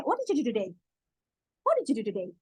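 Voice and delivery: quick and linked, sounds swallowed; fast native English. Shown as a demonstration. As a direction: run the words together like a native. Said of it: "Cepet banget kan?". (cepat dan menyambung seperti penutur asli)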